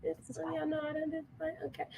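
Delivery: soft, hushed spider panic; hushed alarm. Real, not acted. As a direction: hush it, eyes on the spider.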